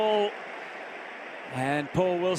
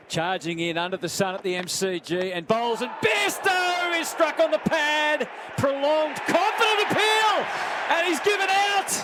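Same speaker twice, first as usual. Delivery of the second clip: rising excitement; 8 semitones higher than usual. Real, not acted. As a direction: lift the voice as the appeal goes up. Bowler appeals for LBW.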